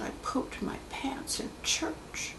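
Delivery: slow, ashamed whisper; whispered confession. Retold or imitated. Client in regression admits his shame, slow whisper. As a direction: breathe it out slowly, embarrassed.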